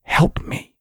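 a voiceless, ghostly whisper; whispered plea for help from nowhere; eerie whisper. A narrator's reading. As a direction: breathe it out like a trapped voice begging. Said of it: "sounded like someone whispering".

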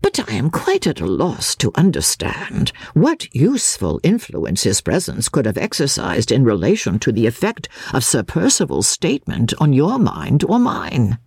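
ordinary delivery

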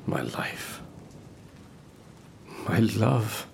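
unvoiced, barely there; breathy whisper. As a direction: let it fall away to nothing. Despairing monologue.

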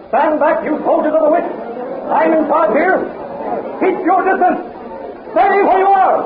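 high, ringing commands to soldiers; commanding shout. Acted. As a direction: hold them back with a shout. Khan holds off soldiers.

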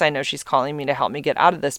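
usual speaking voice

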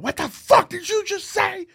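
high-pitched strained mock scream; retold scream. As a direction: imitate yourself screaming in a night terror. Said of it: "I just started screaming at him".

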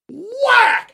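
high, punchy exclaimed sound effect; high-pitched storytelling outburst; sudden whack. Retold or imitated. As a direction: burst it out like a slap. Imitates the smack in story.

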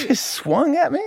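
squeaky indignant boxer voice; high mock Tyson. Comic Tyson squeaking at the man who swung at him.

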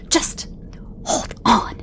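sharp, hushed warning; harsh whisper. Acted. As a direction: hiss it out, a warning. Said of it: "she whispered harshly".

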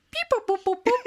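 high robot beeps; playful boop. Shown as a demonstration. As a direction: chirp it like a droid. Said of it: "Or did it sound like".

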